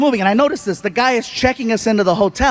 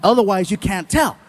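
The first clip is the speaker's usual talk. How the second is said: ordinary talk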